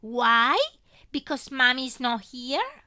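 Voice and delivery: put-on loud toddler question; a small child asking without knowing; innocent little girl. A narrator's reading. Little girl asking her grieving father, innocent.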